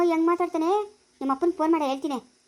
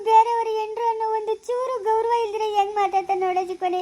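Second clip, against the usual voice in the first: crying; 3 semitones higher than usual. Acted. Insulted wife complains to her husband, theatrical crying wail. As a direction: lament in long sobbing phrases. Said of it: "ಅಳ್ಬ್ಯಾಡ್ ಕಣೆ ಸುಮ್ಕಿರೆ".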